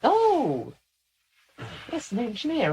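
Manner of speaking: high squeaky realisation; Leica. Acted. Channeled lieutenant reacts to the veteran's trade, high and squeaky. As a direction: exclaim in a high character voice. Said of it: "somebody that has a very squeaky voice".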